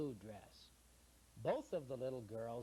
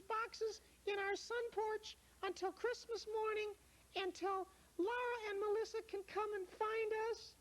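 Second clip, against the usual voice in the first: very high, gushing; a child thanking her rescuer; high girl voice, delighted; relieved little girl. Acted. Lara relieved.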